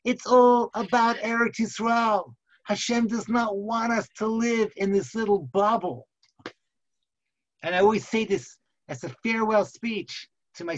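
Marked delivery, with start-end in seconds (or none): 0.0-4.9 rousing proclamation. Acted. Leader answers the couple after the choir, booming proclamation. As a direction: proclaim it to the crowd, stretching the words.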